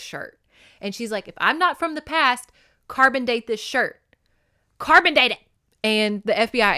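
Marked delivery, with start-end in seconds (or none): 1.3-5.4 higher, louder insistent demand; rising to a loud mock demand. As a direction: push it, she's sure of herself. Julia demands carbon dating.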